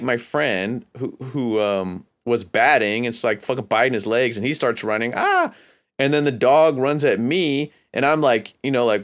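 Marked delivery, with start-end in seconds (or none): 5.1-5.5 high-pitched mock scream; imitated scream. Storyteller as friend running from the dog, high mock scream. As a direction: mimic a frightened yelp, pitched way up.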